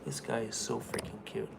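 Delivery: soft, doting murmur; hushed, fond coo over a pet; cooing softly. Real, not acted. Cooing about his dog.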